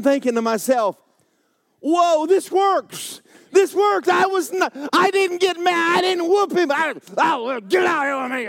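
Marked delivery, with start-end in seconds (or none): 1.8-8.5 squealing, high delight; thrilled inner voice. Retold or imitated. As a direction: bubble over with joy.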